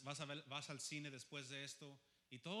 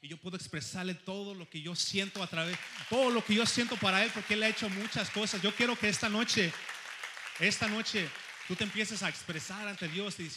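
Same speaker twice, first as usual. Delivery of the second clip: loud, high fervent preaching; preaching at full force; 5 semitones higher, 17 dB louder than usual. Real, not acted. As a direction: drive the appeal home at full volume. (predicando a todo pulmón)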